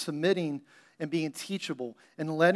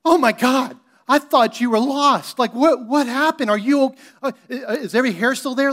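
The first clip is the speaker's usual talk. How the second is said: high, loud panicked mama, slowing on the relief; a mother in a frantic flap over her lost child; hammed-up high, loud hysterical worry; 7 semitones higher, 9 dB louder than usual. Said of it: "that's what you do, right?".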